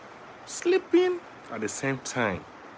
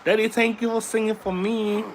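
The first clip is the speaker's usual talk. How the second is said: high, childlike put-on voice; squeaky toddler voice; voicing his baby son; 12 semitones higher than usual. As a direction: speak as the little boy thanking daddy.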